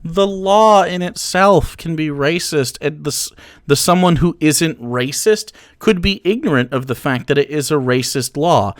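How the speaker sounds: ordinary talk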